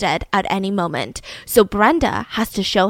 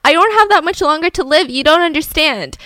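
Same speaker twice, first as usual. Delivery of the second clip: higher, louder acted-out insistence; hammed-up dying-man protest; melodramatic pleading; 5 semitones higher, 4 dB louder than usual. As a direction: plead your doom with mock drama. Boyfriend dramatizes his supposed illness.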